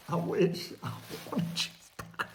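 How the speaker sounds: soft, breathless longing for a cheeseburger; whispered craving. Pretend. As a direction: whisper it like a secret wish.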